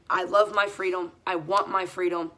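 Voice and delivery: ordinary talk, nothing marked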